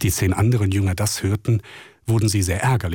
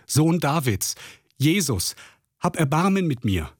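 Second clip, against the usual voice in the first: a blind beggar calling out over the crowd, pitched up; calling out for mercy from the roadside, pitch raised; pleading cry; 6 semitones higher than usual. Acted. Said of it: "rief er laut".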